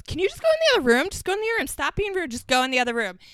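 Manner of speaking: high, rapid-fire irritated scolding; pushy, high-pitched nagging; Ramona. Retold or imitated. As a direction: imitate an exasperated housewife shooing someone out. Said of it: "this is why I love Ramona, goes".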